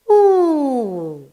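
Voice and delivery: long moo sliding down in pitch; cow's moo. Shown as a demonstration. Teacher telling children what the cow said, drawn-out moo. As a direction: stretch out an imitation cow moo. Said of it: "So the cow said".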